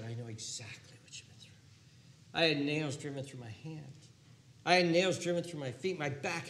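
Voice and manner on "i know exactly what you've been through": soft unvoiced hush; whispered comfort. Jesus whispering to the suffering, hushed. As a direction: breathe it like a secret.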